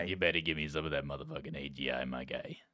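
low, hushed tough-guy demand; put-on tough-guy voice. Co-host pressing his guy for information, put-on tough-guy voice. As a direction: lean on them quietly, voice dropped low. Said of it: "we should just talk like this".

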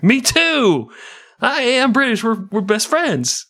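hammed-up high, eager exclamation; mock delighted. André thinking he has found fellow Brits, mock delighted. Voices naive André meeting soldiers.

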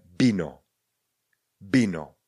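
"bino", vino begun between b and v; v as soft b. Shown as a demonstration. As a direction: model "bino" slowly with a falling end. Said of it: "vyslovujte něco mezi b a v".

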